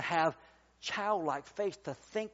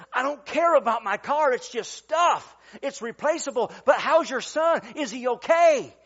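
loud, raised-pitch urgent concern; insistent plea; 6 semitones higher, 9 dB louder than usual. Retold or imitated. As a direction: retell it emphatically, pleading over the other man's cursing. Retold plea to cursing driver.

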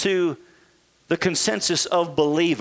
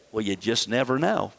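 mock doubt; 10 semitones lower, 5 dB quieter than usual. Mocking doubtful believer voice.

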